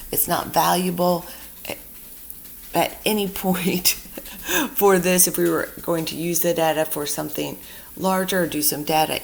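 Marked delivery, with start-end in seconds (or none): 3.9-4.7 quick high laugh. Real, not acted. Laughs at own remark.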